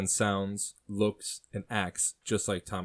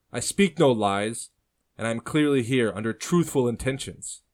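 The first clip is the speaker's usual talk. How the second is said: put-on louder, insistent young voice; earnest young man; 3 semitones higher, 7 dB louder than usual. A narrator's reading. Prisoner proving kinship to his uncle, insistent.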